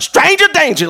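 high, loud yelled chant; shout. Acted. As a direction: scream it out at the devil. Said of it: "you need to be screaming out".

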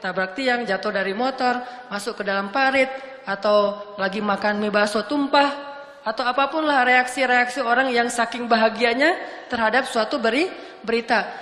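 ordinary talk

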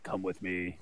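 eerie death voice. Pretend. Death face voice, deep.

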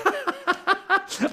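high, rapid-fire mad cackle; crazy professor cackle. Real, not acted. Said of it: "sound like a crazy professor".

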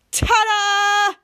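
loud, high showy reveal; excited ta-da. Retold or imitated. Two brothers unveiling their gift with a loud ta-da. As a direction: present it like a grand magic trick. Brothers present beard-hair sweater.

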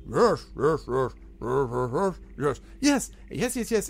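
a goblin putting on a big ogre "Yes"; goblin mimicking an ogre. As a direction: ape a big ogre's "Yes". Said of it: "he mimics this big".

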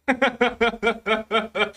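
risada real em sequência, contando a história; risada solta. Real, not acted. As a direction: ria da própria história. Ri da própria história. (gargalhada espontânea)

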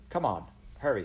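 usual speaking voice

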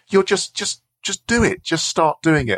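high, impatient-sounding prodding; imitated insistent mentor. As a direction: cut off excuses, pitched high. Mentor urging, cutting her objection.

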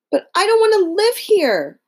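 put-on high, loud little-girl protest; Dorothy crying out. Dorothy protests to the Scarecrow, high and loud. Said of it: "cried Dorothy".

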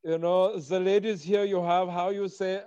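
hesitant foreigner with a mock German accent; foreigner from Berlin. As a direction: play the German searching for English words. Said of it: "I do sometimes do the foreigner".

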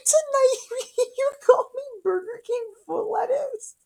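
pitched far up in a squeaky, laughing mock. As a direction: squeal it out high while cracking up. Mock bedroom line, laughing.

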